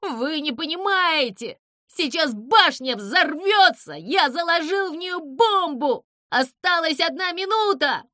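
high, loud, urgent cries; frantic high-pitched alert; panicked warning. Acted. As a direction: shout the alarm desperately. Zyza warns of a bomb.

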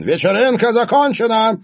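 high-pitched, commanding shout; loud shout. A narrator's reading. Father shuts down the party, shouting. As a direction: call it out over the crowd. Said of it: "вдруг громко крикнул он".

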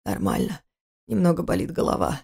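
низкий, тихий, через силу; муж скрывает боль от жены; усталый мужской голос. Acted. Избитый муж успокаивает жену. (низко и тихо, устало)

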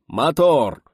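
loud, high command ringing out; drawn-out shout. A narrator's reading. Director cues the sound crew, drawn-out shout. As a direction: shout the cue long across a set. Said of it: "протяжно закричал Гайдай".

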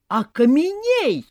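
high, slow, drawn-out spell; shout. A narrator's reading. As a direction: yell the spell again. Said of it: "снова закричал Вася".

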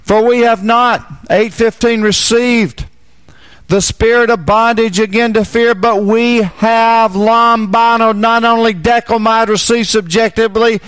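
high, loud fervent preaching voice; full-force preaching. Acted.

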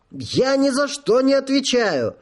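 very high falsetto cry; falsetto shout. A narrator's reading. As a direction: shriek it up in falsetto. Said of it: "фальцетом выкрикнул он". (выкрикнуть фальцетом)

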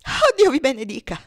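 high, loud, overcome blessing; impassioned thanks, near sobbing; tearful exclamation. A narrator's reading. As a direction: cry out in overwhelmed gratitude. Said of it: "esclamò la donna ... e singhiozzando".

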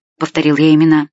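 usual speaking voice

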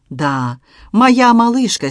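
high, slow and tender. Acted. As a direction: speak lovingly of his baby daughter. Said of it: "с нежностью произнёс мужчина".

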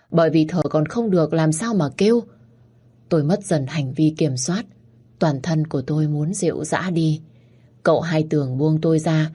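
ordinary delivery

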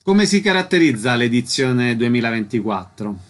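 usual speaking voice

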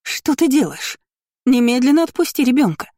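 wailing outrage. A narrator's reading. Mother demands the child be released, wailing. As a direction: cry out a frantic order. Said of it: "голосила моя мама".

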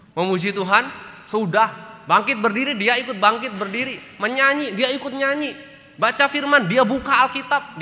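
high, loud listing of each step; mock enthusiastic. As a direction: paint the husband copying the TV service. Preacher mimics husband's TV routine.

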